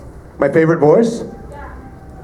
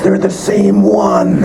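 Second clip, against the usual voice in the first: joking shout; 7 semitones higher, much slower than usual. Acted. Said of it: "Listen to their voices".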